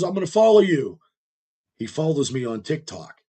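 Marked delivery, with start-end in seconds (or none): 0.0-1.0 higher, louder friendly hail; imitating Antonio Brown. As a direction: play the star greeting his fan.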